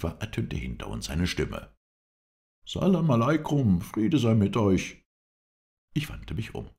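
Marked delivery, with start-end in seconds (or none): 2.7-5.0 high, loud hail from behind; loud greeting. Acted. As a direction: call the greeting out to travellers. Stranger hails the party from behind.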